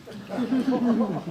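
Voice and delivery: sudden high laugh; high burst of laughter. Real, not acted. Laughing at his own naming of the dog.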